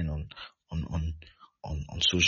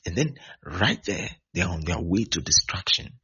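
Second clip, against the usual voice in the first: ordinary delivery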